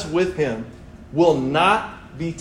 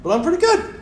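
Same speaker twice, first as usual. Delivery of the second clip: much higher, put-on cheery reply; fake cheerful; 5 dB louder, faster than usual. Telling church friends he is fine, fake cheerful. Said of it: "And I was like".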